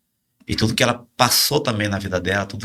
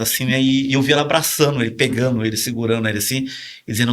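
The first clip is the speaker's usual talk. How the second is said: ordinary delivery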